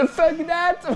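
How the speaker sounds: imitation suraiguë, entre deux fous rires; lâche « fuck that » en voix de fausset ridicule; imitation ratée. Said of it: "la pire imitation du monde". (très mauvaise imitation, voix haut perchée et hilare)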